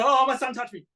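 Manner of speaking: high, loud mock cry of pain; mock pained client. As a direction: ham up a client flinching at the needle. Mock client reaction during tattooing.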